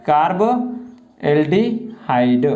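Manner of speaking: very slow कार्बोल्डिहाइड, broken into separate parts; syllable-by-syllable dictation. Shown as a demonstration. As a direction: stretch out each piece of कार्बोल्डिहाइड. Teacher dictates the term in pieces.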